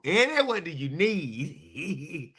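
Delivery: an alum coaxing Eddie what he needs; pleading alum. Pretend. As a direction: beg him gently like an eager fan. Voicing alumni asking Eddie.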